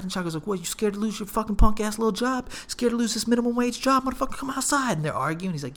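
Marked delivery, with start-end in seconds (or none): 0.5-5.0 high, heated taunting rant; retold angry taunt. Friend dares bouncer to risk his job, angry taunt. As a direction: goad him into a fight, in a raised pitch.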